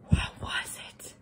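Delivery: breathy suspense; whisper. A narrator's reading. Wolf wondering what he smells, whispered. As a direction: lean in and whisper.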